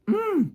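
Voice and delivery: high-pitched held "hmm"; cringing squeal. Acted. Vlogger showing viewers how cringe moments feel, cringing high hum. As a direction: squeal through closed lips in discomfort. Imitating the wish to skip cringe.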